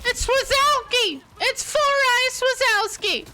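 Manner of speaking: high drawn-out call across the map; calling from far away. Real, not acted. Spotting the boss, calling out.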